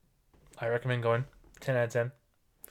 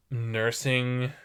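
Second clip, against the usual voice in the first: usual speaking voice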